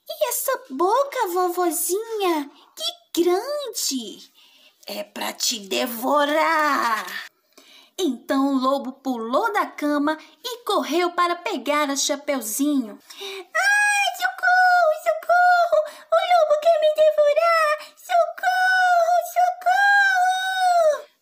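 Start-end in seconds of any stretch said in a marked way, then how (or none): 0.1-4.3 put-on high, wide-eyed child's voice; amazed little girl. Girl marvels, higher. 4.8-7.1 menacing wolf. Acted. Wolf reveals itself. 13.1-21.1 very high, loud panicked screams; screaming for help. Acted.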